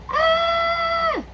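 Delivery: high shrieking animal yell; gorilla yell. A narrator's reading. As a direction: yell as if bitten on the nose. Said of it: "the gorilla yelled".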